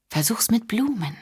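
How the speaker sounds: high teasing whisper; whispered tip. Acted. Rachel teases Michael, whispering the hint. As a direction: let the last advice slip out in a whisper.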